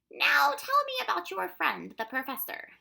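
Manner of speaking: high, loud questioning official; Proc's character voice. A narrator's reading.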